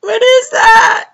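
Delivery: very high, loud mock shriek; imitated scream. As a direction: screech it slowly, imitating someone screaming. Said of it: "she started screaming".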